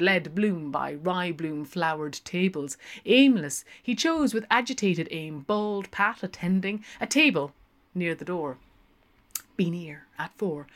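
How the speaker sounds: ordinary delivery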